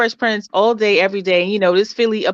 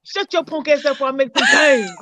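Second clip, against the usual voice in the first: a hint of Martin's brash threat, higher and laughing; mock Martin threat; 4 semitones higher than usual. Retelling Martin threatening the stuffed Rottweiler, half laughing. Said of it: "he said".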